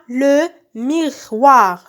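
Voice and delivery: le miroir drawn out with gaps between syllables; slow sounding-out. Shown as a demonstration. Teacher naming the second picture for pupils, slow sounding-out. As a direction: stretch le miroir as LE, MI, ROIR.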